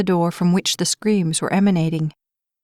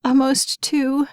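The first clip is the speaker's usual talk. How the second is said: tearful; 6 semitones higher, slower than usual. Acted. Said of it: "Helpless tears streamed down the mother's face".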